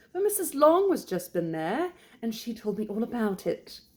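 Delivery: high, gossipy British lady; British wife. Acted. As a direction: pass on gossip in a lifted, fussy voice. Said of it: "she returned".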